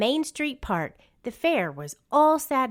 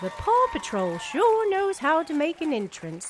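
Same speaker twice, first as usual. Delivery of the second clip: higher, bright exclaim of delight; the mayor cheers the helicopter landing, higher and delighted; 7 semitones higher than usual. A narrator's reading. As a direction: cheer the pups' grand arrival. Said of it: "the mayor exclaimed".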